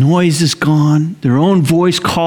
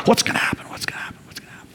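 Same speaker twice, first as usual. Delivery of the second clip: hushed whisper; 11 dB quieter, much faster than usual. Acted. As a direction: whisper fading away to almost nothing. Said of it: "this hush that has to go across".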